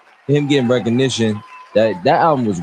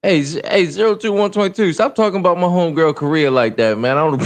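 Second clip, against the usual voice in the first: tipsy rambling to chat; 7 semitones higher than usual. Real, not acted. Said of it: "I'm drunk. ... kinda tipsy now".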